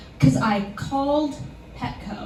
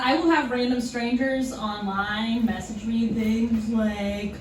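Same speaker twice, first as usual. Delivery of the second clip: usual speaking voice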